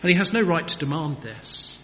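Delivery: ordinary delivery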